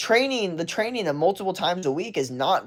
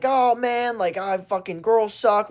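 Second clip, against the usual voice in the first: higher, dismissive mock grumble; mock defensive whine; 7 semitones higher, slower than usual. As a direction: whine it like a sulky excuse. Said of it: "Like, that's defensive".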